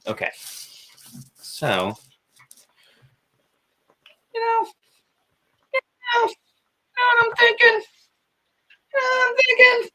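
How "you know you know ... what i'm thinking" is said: very high, loud cartoonish squeak; silly squeaky voice. Artist teasing viewers while drawing, silly high squeak. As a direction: squeak it playfully in tiny high bursts.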